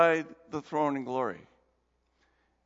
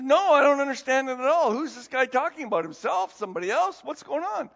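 confused eunuch; 5 semitones higher, 5 dB louder than usual. Retold or imitated. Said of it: "He says".

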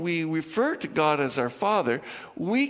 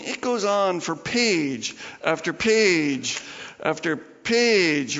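ordinary talk, nothing marked

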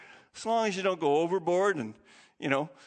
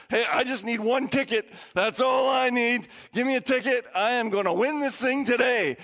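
eager boast; 6 semitones higher than usual. Retold or imitated. Roger buying lottery ticket eagerly.

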